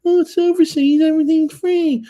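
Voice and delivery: very high, loud mocking squeak; mock a know-it-all in a shrill falsetto; squeaky mockery of a silly know-it-all; silly Billy voice. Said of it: "some silly Billy coming on saying".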